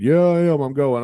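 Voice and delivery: loud, high defiant comeback; stubborn dad. Retold or imitated. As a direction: imitate a dad digging his heels in. Voices stubborn dad arguing back.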